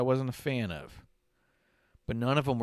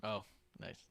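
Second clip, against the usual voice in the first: ordinary talk, nothing marked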